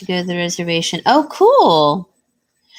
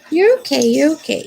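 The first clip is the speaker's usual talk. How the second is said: reassuring dog; 5 semitones higher, 3 dB louder than usual. Real, not acted.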